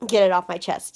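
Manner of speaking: ordinary delivery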